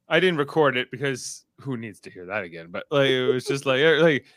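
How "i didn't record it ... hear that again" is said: usual speaking voice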